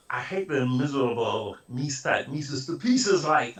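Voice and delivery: cartoon cat voice, high and slow; Mr. Jinks cat voice. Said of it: "the first Doris Butler voice I did".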